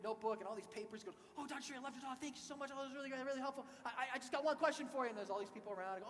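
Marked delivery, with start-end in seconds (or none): 1.4-5.1 excited fan. Retold or imitated. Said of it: "he came up all excited".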